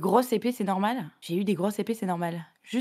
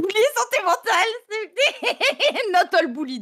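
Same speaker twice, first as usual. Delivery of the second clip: laughing, drawn-out slogan; 9 semitones higher, 4 dB louder, slower than usual. Pretend. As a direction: laugh it out slowly.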